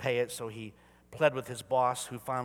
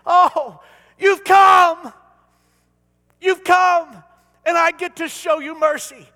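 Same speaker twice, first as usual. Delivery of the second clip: much higher, loud, overjoyed welcome shout; joyful God; 20 dB louder, slower than usual. Retold or imitated. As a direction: shout it like a delighted father at the door. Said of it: "He's gonna say".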